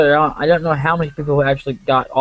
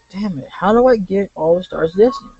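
higher-pitched exasperated outburst; retold griping in a raised pitch; grated frustration; 6 semitones higher than usual. Player griping at the game over missing stars, mildly exasperated. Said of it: "started to grate on me".

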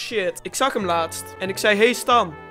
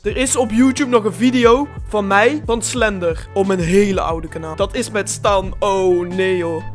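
ordinary talk

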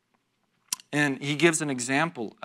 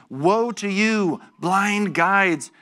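drawn-out, raised woe; slow forceful woe; 4 semitones higher, 4 dB louder, much slower than usual. Acted. Jesus denouncing blind guides, slow and forceful. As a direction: hammer each word slowly. Preacher voicing Jesus's rebuke.

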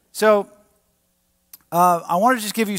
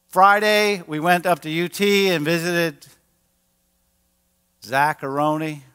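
ordinary talk, nothing marked

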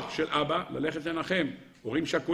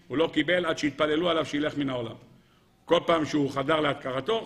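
normal talking voice

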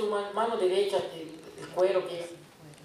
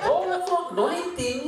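high mock Japanese-accented exclamation; 9 semitones higher than usual. Preacher imitating the Japanese clerk, high, with accent. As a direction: bounce up on oh like the clerk. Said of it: "con su acento japonés".

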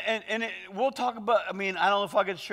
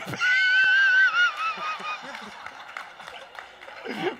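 big laugh. Real, not acted. Preacher bursting out laughing at the interpreter's delivery. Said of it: "got me laughing".

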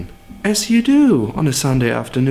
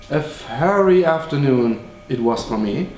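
usual speaking voice

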